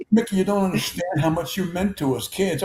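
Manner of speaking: imitated tearful gushing, pitched high; mock weepy fan pouring out devotion; Billy Crystal. Billy to his idol Mickey, mock crying. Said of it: "when he starts crying".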